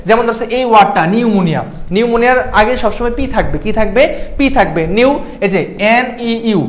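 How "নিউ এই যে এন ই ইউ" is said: slow spelling. Shown as a demonstration. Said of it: "এরকম ভেঙে ভেঙে পড়বা".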